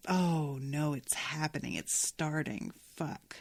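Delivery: quiet dread. Retold or imitated. Dread at a crush starting.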